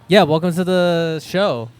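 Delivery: host voice. Pretend. Podcast host welcome.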